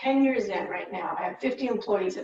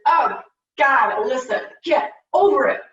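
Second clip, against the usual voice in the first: loud, exasperated brush-off; blunt scolding; 3 semitones higher, 10 dB louder than usual. Retold or imitated. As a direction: tell her to snap out of it, loudly. Mom's blunt reply on phone.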